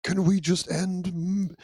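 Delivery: soft, breathy little bear voice; lightly voiced gentle cartoon bear; Winnie the Pooh voice. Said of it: "Winnie the Pooh was capable of blackmail".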